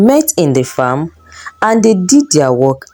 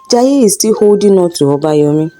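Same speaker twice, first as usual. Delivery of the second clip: plain reading voice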